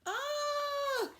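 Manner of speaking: high startled "ah"; a duck shocked by what popped out; shocked cry. A narrator's reading. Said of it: "cried Dora".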